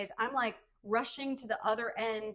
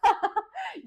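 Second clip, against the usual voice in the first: loud high laugh; burst of laughter; 13 semitones higher, 10 dB louder than usual. Real, not acted. Friend laughing hard at the joke, loud high laugh.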